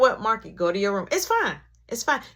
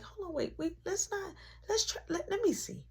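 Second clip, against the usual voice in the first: grandpa intervening; 10 semitones higher, 10 dB quieter than usual. Retold or imitated.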